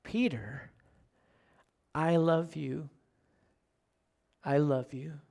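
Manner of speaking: Jesus assuring Peter, fading into a whisper; tender, fading. Retold or imitated. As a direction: let each I love you fade away gently. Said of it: "the repetition is saying".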